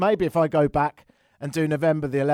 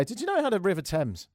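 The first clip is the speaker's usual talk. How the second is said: ordinary talk, nothing marked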